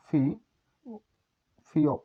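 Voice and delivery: ফি alone and held, then the whole ফিওপ; slow model pronunciation. Shown as a demonstration. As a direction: build up from ফি to ফিওপ. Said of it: "ফিওপে উচ্চারণ হয়". (আগে ফি, তারপর পুরো ফিওপ উচ্চারণ)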